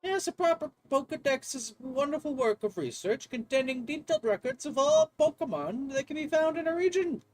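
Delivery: earnest, lecturing English professor; eager game professor. Acted. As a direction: share a dream in a lilting academic voice. Voices the game's professor.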